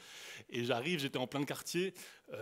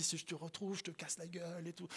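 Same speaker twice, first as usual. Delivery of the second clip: softer, muted mock threat; retold threat; 3 semitones higher, 8 dB quieter than usual. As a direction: throw off the threat under your voice. Retold threat from angry man.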